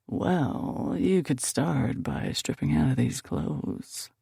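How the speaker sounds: lightly voiced flirty man; slow, drawn-out suggestive line; flirty Nick. A narrator's reading. Nick flirting with the narrator, slow and suggestive.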